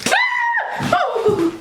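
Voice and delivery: sudden very high scream then yelp; startled scream. Real, not acted. Screaming in fright at the lighter flame. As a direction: jump at the flame. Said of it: "every time I get scared".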